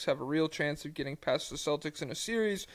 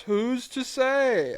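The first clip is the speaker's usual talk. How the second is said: mock ponderous; 8 semitones higher, 3 dB louder, much slower than usual. Reading listener question theatrically.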